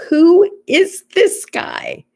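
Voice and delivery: high, loud, drawn-out incredulous question; amused disbelief. Retold or imitated. Caller privately baffled by the odd repairman, amused disbelief. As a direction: marvel at it, stretching each word high.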